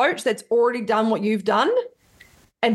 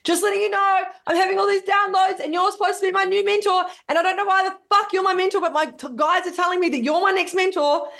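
excited message; 10 semitones higher than usual. Retold or imitated. Quoting her message to mentor.

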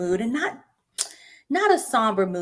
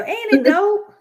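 excited; 12 semitones higher, 7 dB louder, slower than usual. Real, not acted. Host hyping her intro.

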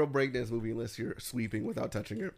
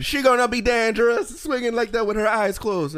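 loud, higher mock Jamaican awe; Jamaican accent; 8 semitones higher, 10 dB louder than usual. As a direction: marvel at her in a hammed-up Jamaican accent, loud. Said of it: "my Jamaican".

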